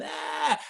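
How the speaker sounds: very high shrieked mock scream. Counselors in diapers screaming in fake alarm.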